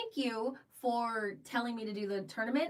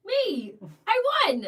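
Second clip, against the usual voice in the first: much higher and louder, gleeful boast; triumphant; 4 semitones higher, 9 dB louder, much slower than usual. Real, not acted. Telling listeners she won, triumphant high shout. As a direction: crow the win, rising to a squeal. Bragging about winning tournament.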